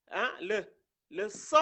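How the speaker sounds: ordinary talk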